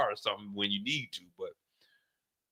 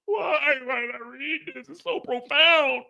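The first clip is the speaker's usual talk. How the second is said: mock sobbing; 13 semitones higher than usual. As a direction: whimper it like a hammed-up crier. Mock crying over reading.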